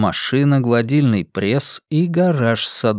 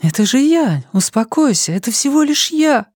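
urgent reassurance; 12 semitones higher than usual. Acted. Calming terrified sister.

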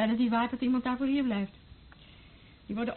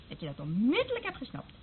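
ordinary talk, nothing marked

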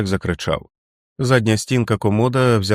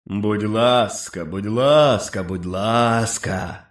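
very slow, drawn-out monstrous mimicry; inhuman chorus; much slower than usual. A narrator's reading. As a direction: stretch the pleas out like beasts mocking. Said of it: "хор нелюдських голосів".

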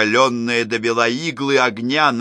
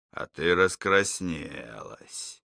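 low taunt; 5 semitones lower, 7 dB quieter, slower than usual. A narrator's reading. Drunk husband taunting wife.

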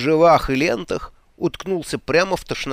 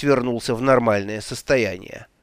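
ordinary delivery, nothing marked

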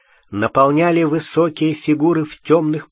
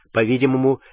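usual speaking voice

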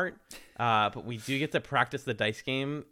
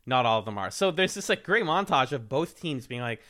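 ordinary delivery

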